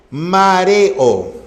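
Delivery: "mareo" syllable by syllable, e apart from o; hiatus separation. Shown as a demonstration. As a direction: split "mareo" slowly as ma-re-o. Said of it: "E O, vocales abiertas, se separa".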